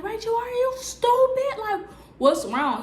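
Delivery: high, loud incredulous yell; mock friend yelling. Friend running down the street, high incredulous yell. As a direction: shout it up high in disbelief. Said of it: "She running down the street like".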